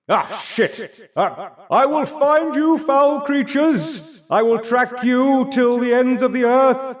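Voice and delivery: high, booming vengeful hero roar; Sir Gelwin's voice. Acted. Game master as a hero hunting the fleeing party, furious high shouting. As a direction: shout slow vows of pursuit, high and loud. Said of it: "you hear the voice of Sir Gelwin".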